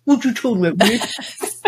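playful catchphrase imitation ending in a laugh; lightly put-on sitcom catchphrase; Arnold. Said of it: "It was Arnold that said".

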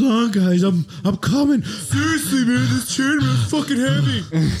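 strained out of breath. Acted. Character struggling in armor.

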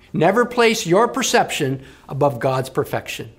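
ordinary talk, nothing marked

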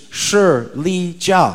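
slow, separate syllables: Shi, Li, Jia; Chinese pronunciation. Shown as a demonstration. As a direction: teach "Shi Li Jia" one syllable at a time. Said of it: "How you say Snickers in Chinese".

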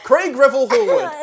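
laughing name call. Real, not acted. Friend laughing while naming the judge, drawn out. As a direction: draw the name out through a laugh.

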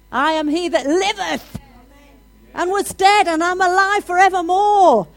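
preacher shouting victory to the church; climactic preaching shout. Acted. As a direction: lift the room with a triumphant shout.

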